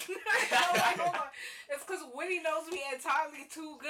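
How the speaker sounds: laughing, flustered and loud. Real, not acted. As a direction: laugh through the words, caught out. Laughing at being exposed.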